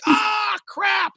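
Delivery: high, yelping Southern-free "Ah, crap" of a let-down caller; frustrated yelp. Retold or imitated. Radio contest caller who just missed the prize, frustrated yelp. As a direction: squeal out your disappointment.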